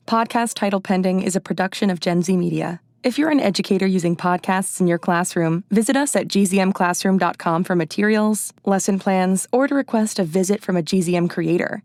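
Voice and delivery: steady credits announcer voice; announcer read. Acted.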